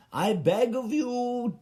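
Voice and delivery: high, slow theatrical plea, beg of pushed high; grand begging. Pretend. Begging the HBO board in a grand high plea. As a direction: beg of you rising grandly like a courtier. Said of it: "I was writing a letter".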